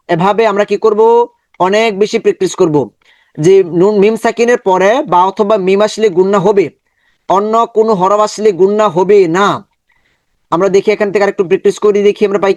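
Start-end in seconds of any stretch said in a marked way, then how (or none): none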